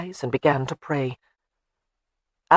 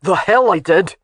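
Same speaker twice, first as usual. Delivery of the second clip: loud playful Scottish retort; grinning retort; 3 semitones higher, 6 dB louder than usual. A narrator's reading. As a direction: fire it back with a grin. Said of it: "he countered with a grin".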